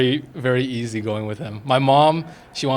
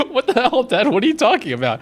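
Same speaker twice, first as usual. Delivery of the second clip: higher, louder incredulous jab; mock disbelief; 11 semitones higher, 5 dB louder than usual. Son retelling teasing his dad, mock incredulous. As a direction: retell your own baffled teasing of dad. Mocking dad's odd phone voice.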